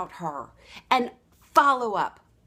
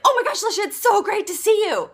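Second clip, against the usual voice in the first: much higher and louder, thrilled to see her; gushing greeting; 11 semitones higher, 7 dB louder than usual. Retold or imitated. Friend gushing over seeing Lisha again, thrilled and loud. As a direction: squeal the hello like a delighted friend. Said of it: "If somebody is like this".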